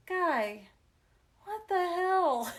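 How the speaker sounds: loud, slow, high "what the hell"; exasperated. Retold or imitated. Sister fed up with her brother smashing her craft, exasperated. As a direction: let it out, fed up with your brother. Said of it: "just the inflection and the tone".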